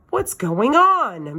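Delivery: high, slow, stern demand; teacher demanding to know what happened. A narrator's reading. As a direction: draw it out like a teacher demanding answers. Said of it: "Missus Chu demanded".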